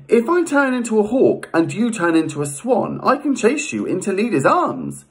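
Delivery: high-pitched storybook goddess; high, animated character voice for a goddess; Aphrodite's scheming proposal. Acted. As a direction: pitch it high as an eager goddess laying out a plan. Voicing Aphrodite hatching a plan.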